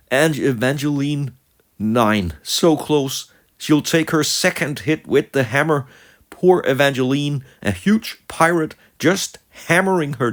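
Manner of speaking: high, loud, agitated play-by-play; dramatic lament. Real, not acted. Lamenting the fighter being beaten.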